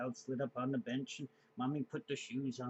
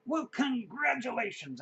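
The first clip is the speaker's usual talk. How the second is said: slow, high, hammed-up well-wishing; mock-grand congratulations; 10 semitones higher, 4 dB louder than usual. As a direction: play it overly gracious. Teller's mock-formal reply to boy.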